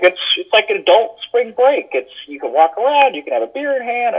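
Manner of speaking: professor quote. Retold or imitated. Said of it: "He's like".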